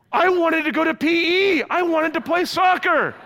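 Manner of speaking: put-on high, loud childish wail; hammed-up kid meltdown; mock crying kids. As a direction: imitate upset children protesting tearfully. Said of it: "And they start crying".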